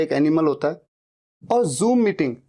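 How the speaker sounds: ordinary talk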